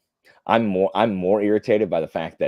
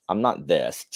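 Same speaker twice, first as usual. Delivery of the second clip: ordinary delivery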